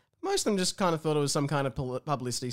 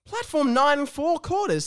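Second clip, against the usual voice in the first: train conductor calling; 9 semitones higher, 5 dB louder, slower than usual. Acted. Announcing platform in character.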